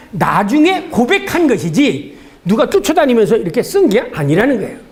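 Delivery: ordinary talk, nothing marked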